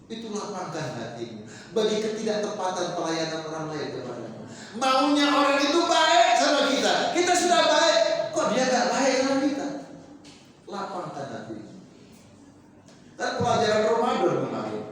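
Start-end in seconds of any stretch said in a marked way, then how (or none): 4.8-9.7 higher, louder, aggrieved whine; mock indignant complaint. Preacher mimics a congregant resenting unkindness, mock indignant. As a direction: play the offended person who expects kindness back.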